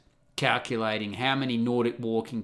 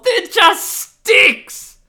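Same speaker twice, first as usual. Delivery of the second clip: high, loud, slow exasperated shouting; outraged rant; 11 dB louder, much slower than usual. Real, not acted. Ranting at the Wikipedia article, slow loud outrage. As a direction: yell it like the idea insults you.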